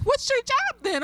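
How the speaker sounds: imitating kids. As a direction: squeak it out like a cheeky child. Student questions the teacher.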